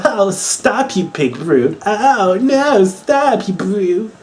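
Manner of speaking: high, swooning mock damsel cry. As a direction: squeal it like a fainting lady. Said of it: "it's such pansy".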